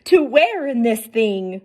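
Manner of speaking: put-on high, fretful boy king; groaning king. A narrator's reading. A lightly voiced King Mo groaning at the parade. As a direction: groan out your worry, childlike. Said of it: "looked pale as he groaned".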